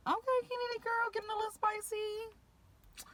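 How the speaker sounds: very high-pitched, playful hype voice; sing-song high voice, stretched at the end; teasing mock reaction. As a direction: squeak it out, impressed and teasing. Acted teasing reaction to quiet friend.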